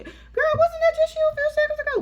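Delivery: high-pitched disbelief at the sister; incredulous callout. Real, not acted. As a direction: squeak out the accusation. Calling out sister's hypocrisy.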